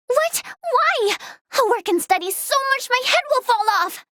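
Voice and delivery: high-pitched, incredulous outburst; dismayed protest. Acted. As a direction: protest in disbelief, pitch jumping up. Protests news about studying in space.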